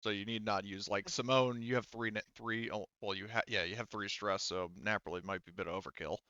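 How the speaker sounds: usual speaking voice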